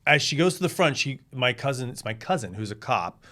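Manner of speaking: usual speaking voice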